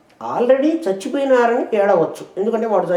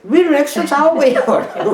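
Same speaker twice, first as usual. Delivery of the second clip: laughing; 6 semitones higher than usual. Real, not acted. Laughing over the joke.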